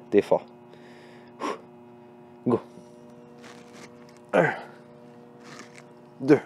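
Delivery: strained counting mid push-up; doing push ups. Real, not acted. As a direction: count through each push-up.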